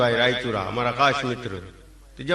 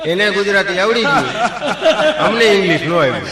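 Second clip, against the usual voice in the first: laughing punchline; 5 semitones higher, 6 dB louder than usual. Real, not acted. Punchline after long laugh.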